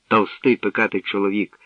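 plain reading voice

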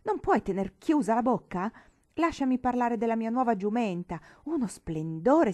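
loud, insistent outburst; grido impaziente. A narrator's reading. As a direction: shout over your sister to get heard first. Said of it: "gridò la signorina Matilde". (grido impaziente e insistente)

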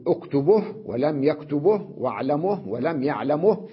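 اكتبه as uktubuh, واعلمه as wa'lamuh; u before each final ه, as in يكتبه and يعلمه; pause with vowel transfer. Shown as a demonstration. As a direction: list اكتبه and the rest, each stopping on h. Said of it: "يقفون عليها بنقل حركة".

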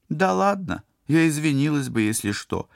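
put-on high, huffy girl's protest; a touch of wounded pride; mildly offended. A narrator's reading. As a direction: start high and let the hurt tail off. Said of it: "обиженно сказала Лика".

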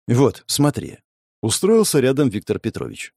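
plain reading voice

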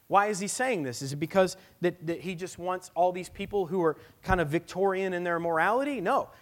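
loud, high, impassioned preaching, slowing on the key words; full-force preaching. Acted. Pastor pleading with the congregation in a loud, impassioned preach. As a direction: preach it hard to the whole room.

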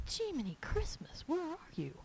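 high, soft little voice; hushed, high-pitched mock exasperation; mock exasperated searching. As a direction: call softly and high for something hiding. Acted exasperated search for the vein.

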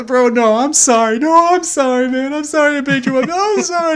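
put-on high, pleading drunk apologies; hammed-up weepy drunk saying sorry; emotional drunk. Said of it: "they're emotional drunks".

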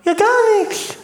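very high, exasperated squawk; indignant outburst. Pretend. Customer telling the seller nothing was lost, indignant high outburst. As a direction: let the voice leap up in protest. Indignant complaint on prank call.

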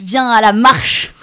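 a laughing, loud call to join; rallying call. Acted. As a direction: shout the invitation to march. Closing call to action.

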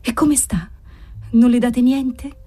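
high, frail questioning voice; nun's faint voice. A narrator's reading. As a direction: ask timidly in a thin, breathy voice. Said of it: "chiese la voce della suora".

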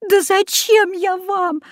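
put-on high, tearful child's wail; girl weeping at the witch; crying girl. A narrator's reading. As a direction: wail it through tears. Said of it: "заплакала Люська".

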